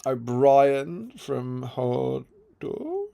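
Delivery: very slow stretched name; drawn-out name. Retold or imitated. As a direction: drag every syllable. Odd drawn-out name read.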